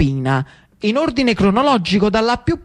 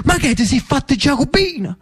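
startled archbishop; 6 semitones higher than usual. Acted. Archbishop's shock.

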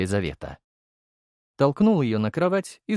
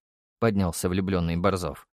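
ordinary delivery, nothing marked

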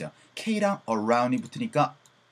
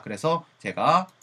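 ordinary delivery

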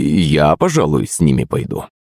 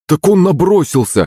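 high, hurried self-defence; frightened excuse; 10 semitones higher than usual. A narrator's reading. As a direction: protest nervously to the boss. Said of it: "начал оправдываться садовник, испуганно". (испуганное оправдание)

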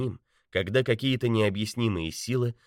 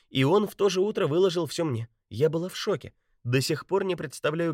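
indignant woman's voice; 8 semitones higher than usual. A narrator's reading. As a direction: pour it out, upset and urgent. Betty indignant, explaining.

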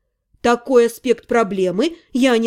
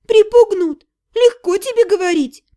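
higher and louder, echoing "Припугнут?"; incredulous; 6 semitones higher, 4 dB louder than usual. A narrator's reading. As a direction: throw his word back in disbelief. Incredulous retort.

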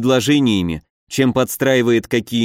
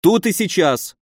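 higher-pitched, insistent challenge; mild yell; 6 semitones higher than usual. A narrator's reading. Nephew demands the duel at once, mildly yelled. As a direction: press the challenge hotly but contained. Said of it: "заорал младший Соболь".

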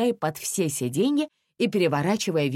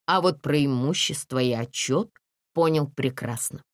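ordinary delivery

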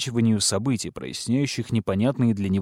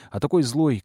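usual speaking voice

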